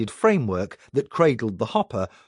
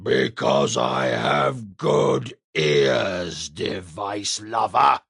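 much lower, slow rumbling giant; deep giant voice; 7 semitones lower, much slower than usual. A narrator's reading. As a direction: rumble it like a menacing ogre. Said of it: "said the giant".

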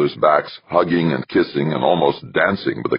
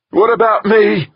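very high, loud wail; distressed cry; 8 dB louder than usual. A narrator's reading. Westfall demanding attention from the two men, crying out. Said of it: "Westfall cried".

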